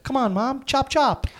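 put-on high, snappy little boy ordering mum about; bossy kid voice. Boy hurrying his mum along, high and bossy. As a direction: play the impatient child hurrying mum along. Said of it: "He was like".

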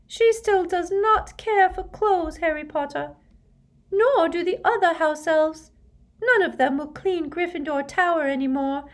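very high, loud, earnest squeak; high-pitched, emphatic elf voice; squeaky house-elf. Acted. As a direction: squeak it as a devoted servant elf. Narrator voices Dobby the house-elf.

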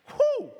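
high excited whoop of amazement; high whew. Real, not acted. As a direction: let out a high whistling whew. Exclaiming over credited obedience.

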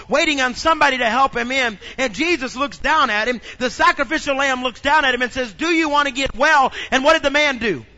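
loud, high, building sermon cadence; full-force preaching. Acted. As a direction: preach it with rising fire.

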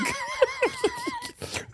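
high shrieking laugh; helpless high cackle; howling laugh. Real, not acted. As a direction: explode into laughter.

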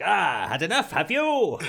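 loud, high upper-crust bluster; mock-heroic battle cry; deluded faux hero. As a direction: crow defiantly like a tiny deluded knight. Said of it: "he's like".

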